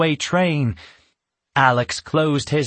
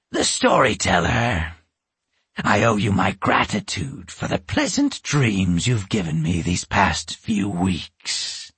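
low, menacing witch voice; 6 semitones lower than usual. A narrator's reading. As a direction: purr it like a sly old witch. Witch Aunt Gris speaks.

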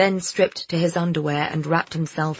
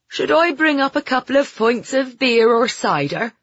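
put-on Somerset accent; 7 semitones higher, 4 dB louder than usual. A narrator's reading. Landlord offers drinks.

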